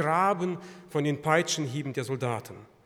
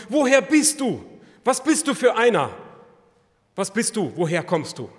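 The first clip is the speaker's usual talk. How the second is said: high, loud anxious questioning; Pilate questioning; 6 semitones higher, 8 dB louder than usual. Acted. Frightened Pilate to Jesus, high and pressing.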